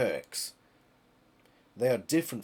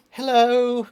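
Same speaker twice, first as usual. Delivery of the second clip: much higher, louder, hammed-up sitcom greeting; Friday Night Dinner dad; 12 semitones higher, 12 dB louder than usual. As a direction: belt it out like the over-eager dad from the sitcom. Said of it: "Reminds me of, um, Friday night dinner".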